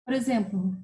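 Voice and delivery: usual speaking voice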